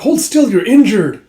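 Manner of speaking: loud, high, drawn-out plea; retold worried shout; acted alarmed scolding. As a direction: urge the wounded man to stop. Restraining an injured king.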